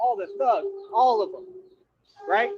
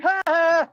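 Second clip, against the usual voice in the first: loud, high mocking "ha ha"; taunting laugh; 9 semitones higher, 7 dB louder than usual. Real, not acted. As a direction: crow a laugh at your opponent. Taunting laugh at the amnesia jab.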